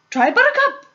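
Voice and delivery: loud, high-pitched eager call; crying out. A narrator's reading. Host urging guests to taste, crying out. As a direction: shout it out like a gleeful host. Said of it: "cried Mister Wonka".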